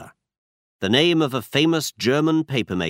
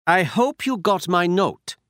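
put-on German accent; 8 semitones higher than usual. A narrator's reading. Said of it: "He had a strong German accent".

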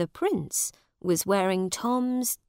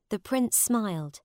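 ordinary delivery

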